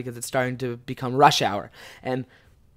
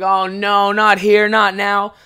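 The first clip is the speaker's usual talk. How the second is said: mock despair; 5 semitones higher, 5 dB louder, slower than usual. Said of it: "being like".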